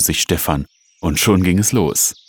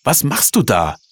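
higher-pitched, startled mother's question; alarmed questioning; 7 semitones higher than usual. Acted. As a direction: demand an explanation from the child. Mutter stellt Sohn zur Rede. (aufgebrachte, höhere Nachfrage der Mutter)

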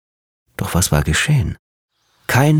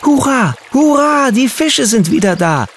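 high, loud cheer of joy; joyful shout; 4 dB louder than usual. A narrator's reading. Boy cheering that the fish are back, loud and high. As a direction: yell it out like an overjoyed little boy. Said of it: "brüllte der kleine Junge vor Freude".

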